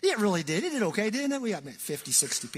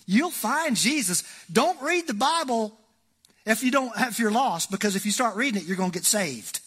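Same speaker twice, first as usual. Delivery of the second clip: fervent preaching; 6 semitones higher, 6 dB louder than usual. Real, not acted. Preaching rising in force.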